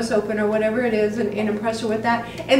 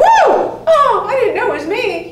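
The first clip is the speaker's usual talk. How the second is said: much higher and louder, laughing as she gasps it out; startled whoa; 11 semitones higher, 7 dB louder than usual. Retold or imitated. Therapist hears a love confession, shocked laughing whoa. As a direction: caught totally off guard by the confession, laughing. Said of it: "And you're like".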